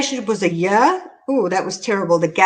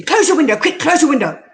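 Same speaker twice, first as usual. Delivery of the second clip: higher and louder, a hurried command; retold urgent shout; 5 semitones higher, 4 dB louder than usual. Passenger ordering the driver to shut the window, retold urgent yell. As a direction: bark it like an impatient passenger. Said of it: "he just started screaming at me".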